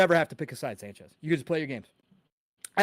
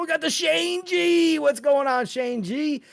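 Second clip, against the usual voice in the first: very high, loud announcer call; hyped shout-out; 11 semitones higher, 4 dB louder than usual. Real, not acted. Host announcing a viewer in chat, hyped. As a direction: stretch the name out like a ring announcer.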